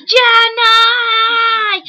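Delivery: high, loud sung call; a loud, high, sung shout of a name. Retold or imitated. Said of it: "And then he goes".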